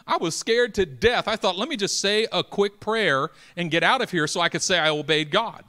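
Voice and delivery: high, loud storytelling; loud preaching story. Acted. Preacher reliving the crash for the church, loud. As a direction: tell it at full pulpit force.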